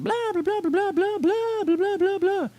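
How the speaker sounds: blah blah rattled off way up high; a friend who won't stop talking about their break; mock chatter. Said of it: "They're like".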